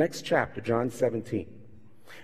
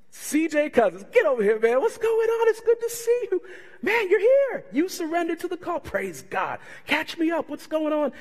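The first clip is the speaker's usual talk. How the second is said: hearty greeting; 13 semitones higher than usual. Retold or imitated. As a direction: call him over with big delight, way up high. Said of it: "He goes".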